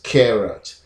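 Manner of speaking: "carrot" with an "eh", KEH-rot; second pronunciation. Shown as a demonstration. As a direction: make the first vowel of "carrot" an "eh". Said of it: "the second pronunciation is".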